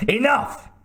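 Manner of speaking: high furious outburst; angry cry. A narrator's reading. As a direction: cut him off with fury. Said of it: "he cried".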